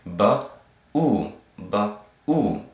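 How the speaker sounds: "ba" and "ooh" as separate sounds with gaps; sounding out. Shown as a demonstration. Teacher breaking down the Shuruk for learners, sounded out. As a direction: split consonant from vowel, "ba", then "ooh". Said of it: "So this would say".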